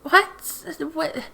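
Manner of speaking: high, incredulous double what; baffled what. Retold or imitated. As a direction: squeak it out in total disbelief. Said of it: "and I'm like".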